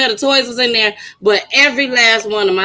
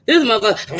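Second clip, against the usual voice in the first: ordinary talk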